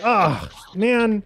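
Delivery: a hint of a let-down card player; lightly voiced disappointed man. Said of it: "he's like".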